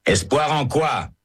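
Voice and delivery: high, slow cry of protest. A narrator's reading. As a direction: fling the question out high and slow. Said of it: "crie Herbert".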